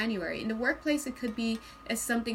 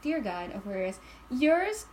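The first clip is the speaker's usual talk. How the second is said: ordinary delivery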